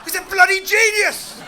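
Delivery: mock-excited fan, raving and pitched high; a hammed-up fan swooning over the steward; gushing fan. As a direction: gush over David like a starstruck passenger. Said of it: "hearing that on the plane and going".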